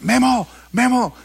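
high, drawn-out mock holler; hammed-up fan's call; imitated calling out. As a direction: hail someone across the street, slowly. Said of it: "cuando me gritan Memo".

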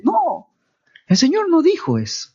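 high-pitched, insistent denial; emphatic refutation. Real, not acted. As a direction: cut in firmly to refute it. Preacher refutes the false teachings.

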